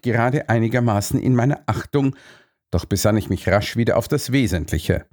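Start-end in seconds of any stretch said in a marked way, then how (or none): none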